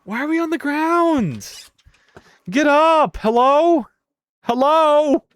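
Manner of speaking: high, loud calling to a fallen character; hammed-up alarmed calls; mock alarm. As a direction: yell at the ragdoll to get up. Character fell on ground, calling out.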